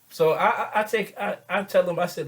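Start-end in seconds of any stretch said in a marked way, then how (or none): none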